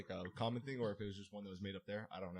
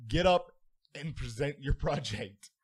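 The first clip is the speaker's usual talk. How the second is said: stern teacher; 4 semitones higher, 9 dB louder, slower than usual. Retold or imitated. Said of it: "He's like".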